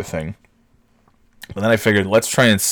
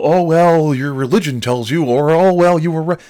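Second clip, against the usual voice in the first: mocking whiny voice; 7 semitones higher, 4 dB louder than usual. Mocking offended viewers' excuses.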